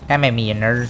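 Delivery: usual speaking voice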